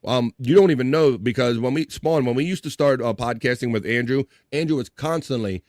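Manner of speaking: usual speaking voice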